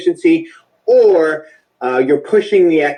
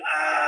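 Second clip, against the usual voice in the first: short high cry from the chest; quick high-pitched release yell; demo yell; 13 semitones higher, 10 dB quieter than usual. Shown as a demonstration. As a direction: let one sharp yell out. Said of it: "Let out a massive yell".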